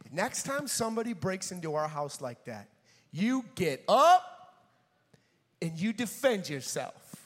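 lightly voiced serious father; stern dad. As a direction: lay down advice to your son. Dad's stern advice to son.

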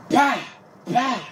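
loud high barked "Bah!" gunshot noises; gunshot imitation. Retelling gunfire across the street, loud mock gunshots. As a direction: blast out the shots like gunfire. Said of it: "you hear this".

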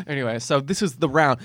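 usual speaking voice

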